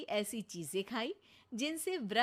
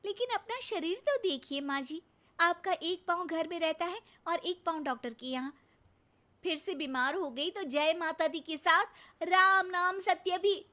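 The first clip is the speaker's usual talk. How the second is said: worried scolding; 5 semitones higher, 6 dB louder than usual. Acted. Daughter-in-law scolds mother-in-law.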